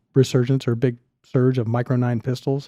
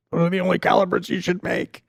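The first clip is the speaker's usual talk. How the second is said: high, put-on complainer voice; mock objection in a raised pitch; mocking naysayers; 6 semitones higher than usual. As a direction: mimic a know-it-all objector. Mocking naysayers in acted quote.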